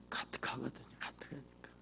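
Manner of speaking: breathy whispered aside. Real, not acted. Preacher whispering to the congregation about a father's devotion, hushed.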